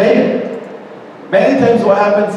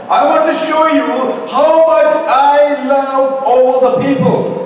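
a hint of a lofty divine voice, rising higher; God's voice; 6 semitones higher than usual. Preacher voicing God to Jonah, lightly lofty. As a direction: speak as a patient, loving God.

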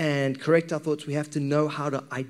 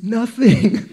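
louder, higher, laughing reveal; 8 semitones higher, 4 dB louder than usual. Real, not acted. Pastor confessing he was thinking nothing. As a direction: burst out with it, laughing.

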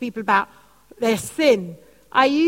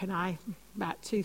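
ordinary talk, nothing marked

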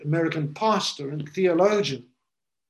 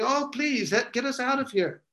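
a hint of a higher desperate plea; pleading cry; 7 semitones higher than usual. Preacher voicing the damned begging God, mild plea. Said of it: "people crying out to God saying".

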